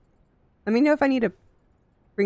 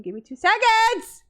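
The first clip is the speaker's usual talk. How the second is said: higher, louder cry of give me two seconds; pleading with the game out loud; exasperated shout to the game; impatient plea; 12 semitones higher, 3 dB louder than usual. Real, not acted. Asking game to wait.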